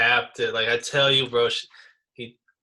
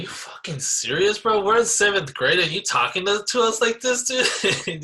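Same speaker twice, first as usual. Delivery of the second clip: higher and louder, outraged disbelief; indignant outburst; 9 semitones higher, 5 dB louder than usual. Retold or imitated. Boy to teacher, retold higher and louder outrage. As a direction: let the outrage climb as you go. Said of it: "I was like".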